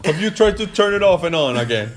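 high, loud put-on English sitcom voice; IT Crowd quote. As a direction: belt it like the sitcom line. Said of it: "I T crowd".

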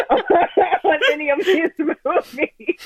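high, slow words through laughter; laughing. Real, not acted. Host joking to cohosts about the series, laughing. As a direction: crack up as you add the jab.

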